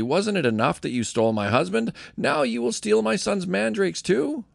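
understated reproach, rising high on the last question; mild anger. A narrator's reading. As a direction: read it with a touch of indignation. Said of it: "But Leah replied angrily".

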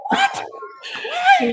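very high, stunned what and why; shocked squeal. Retold or imitated. Podcaster finding he got blocked, shocked high squeal. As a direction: squeal it in disbelief.

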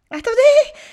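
put-on boy's strangled yell; choking cry. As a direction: shout it out half-choked. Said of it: "dit Pierre en étouffant".

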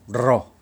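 ロ with a trilled R; rolled R sound. Shown as a demonstration. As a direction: roll the tongue on ロ. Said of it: "これは巻き舌". (巻き舌で「ロ」)

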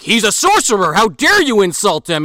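hammed-up outrage on someone's behalf; Goro. Goro defending the sorcerer to Kano, mock indignant. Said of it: "Goro is not putting up with this shit".

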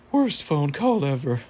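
slow, put-on dying voice; mock dying words. Fives' last words before he dies, slow and hammed-up. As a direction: drag it out like a hammed-up last gasp. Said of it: "right before he dies, he says".

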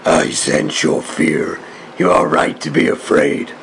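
deep, menacing Sith growl; Darth Maul voice. Pretend. Copying the mask's Darth Maul line.

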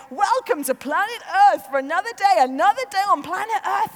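lightly imitated breakfast radio host, loud and fast; a hint of a cheery DJ opening the show; mock radio greeting, upbeat and quick; Chris Evans. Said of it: "he'd do, like".

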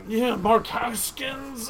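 slow, drawn-out shout of the name; slow excited shout of Mark Haskins. Real, not acted. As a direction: call the name out with glee. Calling out a dream guest.

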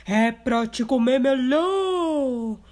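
loud, slow wolf threat; menacing wolf. Acted. Wolf revealing himself before pouncing. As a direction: drop the disguise and threaten.

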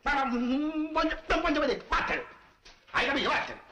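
angry scolding. Acted. Barber chasing off his apprentice, angry shout. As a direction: tell the boy off, chasing him away.

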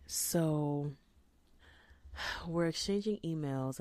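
normal talking voice

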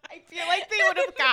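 laughing through the words, high and loud. Real, not acted. Friend cracking up over the crack joke, laughing hard. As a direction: crack up while talking.

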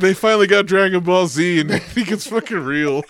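high, loud laughing excitement. Real, not acted. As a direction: laugh through the words. Laughing over the viral clip.

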